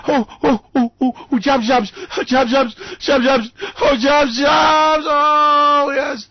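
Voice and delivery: high, loud, building to a shout; mock ecstasy. Kellyanne ecstatic over jobs.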